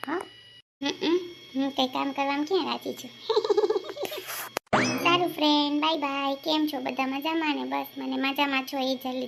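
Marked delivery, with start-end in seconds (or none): 3.2-5.0 giggle. Real, not acted. Laughs at being called lazy.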